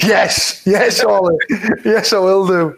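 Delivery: hammed-up eagerness to grab the line; eager yes. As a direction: snap it up like an overeager extra.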